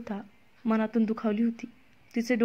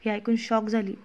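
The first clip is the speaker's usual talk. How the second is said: ordinary delivery, nothing marked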